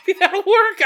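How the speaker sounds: high, loud and laughing. Real, not acted. Laughing at his own gauze-and-tape fix, high and loud. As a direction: crack up through the line.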